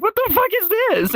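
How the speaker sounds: very high, incredulous squeal; high-pitched mock disbelief; retold shock. Acted shock at strange world.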